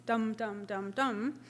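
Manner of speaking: slow, heavy, even dum dum beats; slow spondee beats. Shown as a demonstration. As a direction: thud out each dum slowly and evenly. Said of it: "or slow spondies".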